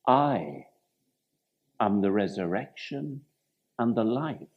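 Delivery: a hushed, weighty promise; solemn tender declaration. Retold or imitated. Jesus' declaration to Martha.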